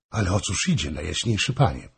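ordinary delivery, nothing marked

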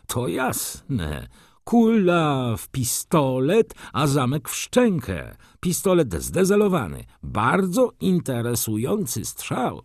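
high, drawn-out voice of an examining expert; egyptologist pronouncing his finding. Acted. (wysoki, przeciągły głos znawcy)

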